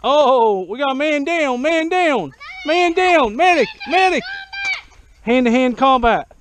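loud, high battle cry; man down shout. Pretend. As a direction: yell for a medic. Mock battle, calling man down.

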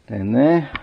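ordinary talk, nothing marked

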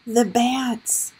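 plain reading voice

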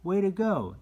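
high, loud "Way to go" cheer. Shown as a demonstration. Toddler cheering on a friend, high and loud. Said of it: "Encourage toddlers to say".

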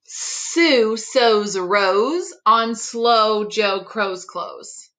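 ordinary delivery

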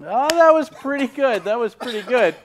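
high amused laugh-filled praise; cracking up. Real, not acted. Laughing after co-host's howl.

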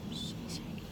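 hushed call to a curled-up pet; whisper. Real, not acted. Owner whispering to the shivering dog Shay Shay. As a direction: whisper the dog's name.

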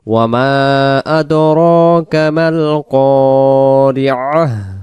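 slow melodic tilawat, long drawn ওয়ামা; slow recitation. Shown as a demonstration. Teacher recites the full verse for students, slow and melodic. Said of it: "তাহলে আমরা উচ্চারণ করব".

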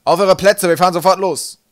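high, very loud command; bellowed order. A narrator's reading. Captain sending crew to their posts, bellowing. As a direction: shout them to their stations. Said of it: "brüllte Wolkenwolf".

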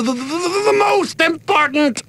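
stammers, then shouts MOST; shouting. Acted. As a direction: build from stammer to a proud shout. Builds to a boastful climax.

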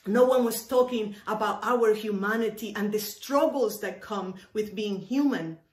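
ordinary talk, nothing marked

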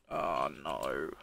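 ordinary delivery

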